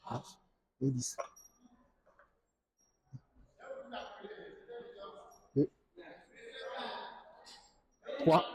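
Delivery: counting reps under strain; counting workout reps. Real, not acted. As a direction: count reps mid-exercise. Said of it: "Je viens de faire une série".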